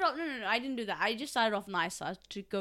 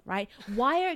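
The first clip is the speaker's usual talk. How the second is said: ordinary talk, nothing marked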